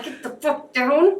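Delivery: slow, drawn-out urgent order to hide; a retold stage-whispered order to the family; urgent hushed command. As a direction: tell them to get down without being heard. Hiding family popping heads up.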